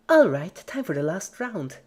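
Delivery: high, bright-toned sing-song nurse voice; chirpy and sinister at once; creepy cheerful Pinkie. A narrator's reading.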